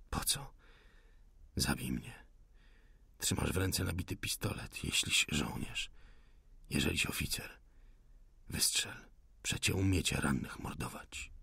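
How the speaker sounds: low, hushed, defiant voice; weak wounded insurgent. A narrator's reading. Wounded insurgent dares the officer to shoot, faintly. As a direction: dare them softly, strength almost gone.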